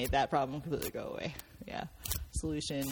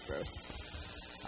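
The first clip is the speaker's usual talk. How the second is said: whispered gross; 13 semitones lower, 12 dB quieter than usual. Real, not acted. Disgusted remark after the reference.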